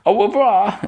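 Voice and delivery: high-pitched theatrical goodbye; airy princess farewell. Retold or imitated. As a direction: play a princess singing out her farewell. Princess's airy parting line.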